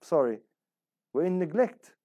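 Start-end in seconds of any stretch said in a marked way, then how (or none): none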